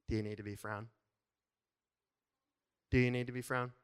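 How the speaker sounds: "frown" said with an f for thr, lightly teasing; mild cutesy "frown" for thrown; playful mispronunciation. Retold or imitated. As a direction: repeat the pet phrase "frown" as God would. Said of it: "I think sometimes God's going".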